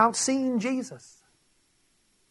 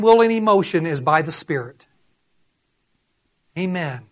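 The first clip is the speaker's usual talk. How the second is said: ordinary delivery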